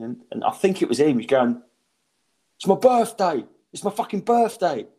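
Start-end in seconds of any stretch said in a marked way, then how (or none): 2.6-4.9 imitated bloke calling out, pitched up; retold heckle. Bloke at the back to comic, insistent call-out.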